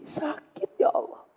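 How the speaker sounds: hushed pained whisper; whispered plea. Shown as a demonstration. Mother whispering her hurt to God, hushed. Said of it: "Bunda kudu belajar gitu".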